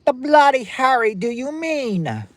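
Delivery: high, loud mock British voice; hammed-up British shout, way up high; British accent. Shown as a demonstration. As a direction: put on a loud, high-pitched British accent, drawn out at the end. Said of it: "That's my British accent".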